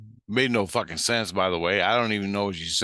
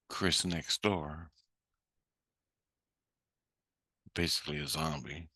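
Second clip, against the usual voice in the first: muttered aside; 4 semitones lower, 9 dB quieter than usual. Real, not acted. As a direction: drop the voice for a sly dig. Muttered aside about neighbour.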